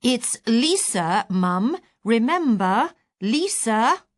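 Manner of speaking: slow, loud, over-patient naming; slow loud reminder. Retold or imitated. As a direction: talk as if to someone stone deaf. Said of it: "Anyone would have thought I was outer Mongolian and stone deaf".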